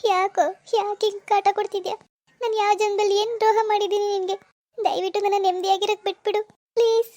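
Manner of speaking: put-on crying girl at her wits' end; tearful pleading. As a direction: plead through tears for some peace. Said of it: "ಅಳ್ಬೇಡ, ಅಳ್ಬೇಡ, ಹೋಗು".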